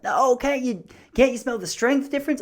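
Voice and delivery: high, laid-back stoner voice; high-pitched stoner offering a sample; imitating the stoner. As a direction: put on the friendly pothead salesman. Imitating the weed shop guy.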